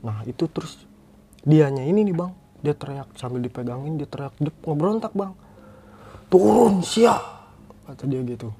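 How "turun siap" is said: loud high possessed shout. Retold or imitated. Possessed friend orders the hikers down, loud high shout. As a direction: bark it out like a spirit taking over.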